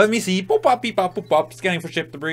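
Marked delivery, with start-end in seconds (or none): 0.4-1.5 high robot beep-boops. Pretend. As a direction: chirp them like a scanning robot. Robot scanning noises.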